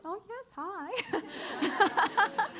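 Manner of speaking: high, slow laughing hello; laughing greeting. Real, not acted. Speaker laughing as she greets an audience member. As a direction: greet them through a laugh.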